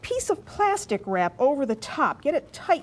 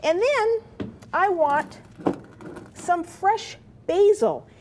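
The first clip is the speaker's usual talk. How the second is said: excited; 7 semitones higher, slower than usual. Real, not acted. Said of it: "I'm getting so excited about this, I can't even talk".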